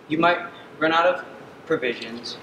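ordinary delivery

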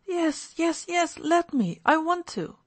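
put-on high-pitched little boy's voice; eager boy. Boy Misha begging for the drink.

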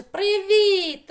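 high, loud, stretched-out hello; letter Ч greeting. Acted. Letter Ч greeting letter Г, high loud call. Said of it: "буковка Ч каже їй".

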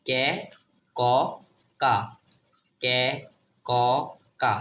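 কে, ক, কা repeated evenly with pauses; syllable drill. Shown as a demonstration. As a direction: drill কে, ক, কা, each vowel distinct. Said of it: "CA কি হবে?". (কে, ক, কা থেমে থেমে বারবার উচ্চারণ)

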